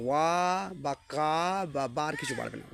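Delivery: usual speaking voice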